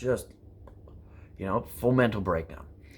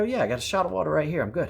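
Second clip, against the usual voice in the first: breezy brush-off; 7 semitones higher, 4 dB louder, much faster than usual. Retold or imitated. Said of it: "And I go".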